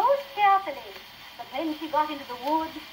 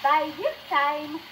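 usual speaking voice

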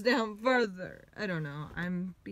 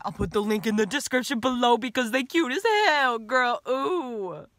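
loud, sassy shout-out; 4 semitones higher, 10 dB louder than usual. Acted. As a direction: hype it up with sass. Sudden sassy plug to camera.

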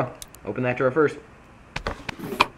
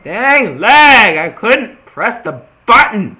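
angry gamer rage shouts; raging at a laggy game, loud and high; furious shouting; 11 semitones higher, 9 dB louder, much slower than usual. Real, not acted. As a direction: yell at the lag in frustration, loud and high. Losing fight to lag.

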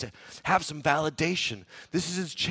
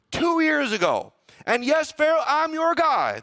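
high, loud, boastful Joseph pleading his case; proud Joseph; 8 semitones higher, 4 dB louder than usual. Retold or imitated. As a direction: puff yourself up, sell yourself to Pharaoh. Preacher voices proud Joseph to Pharaoh.

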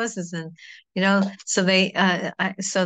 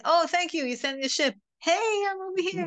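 much higher, eager hail to the ship; relieved calling out; 11 semitones higher than usual. Pretend. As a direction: wave down the rescuers with relief. Rescued man hails the ship.

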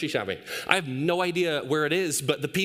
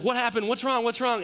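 higher-pitched, urgent questioning; imitated alarmed mother; 6 semitones higher than usual. Wife rushing down to the shrieking baby, mock alarm. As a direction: mimic a mom rushing in alarmed. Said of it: "and she's like".

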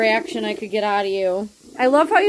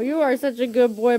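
ordinary talk, nothing marked

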